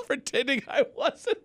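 ordinary delivery

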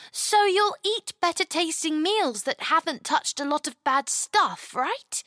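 put-on high, eager little girl; curious child asking how to help; young girl voice. Chloe asks.

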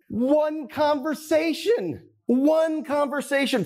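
awed emphasis. Real, not acted. Awed punchline of story.

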